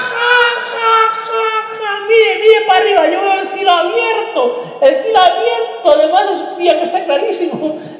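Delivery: high voice, talking through bursts of laughter; gleeful, barely able to speak for laughing; laughing prisoner. Acted. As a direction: prisoner tells the guard he sees open sky, laughing. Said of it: "Les digo".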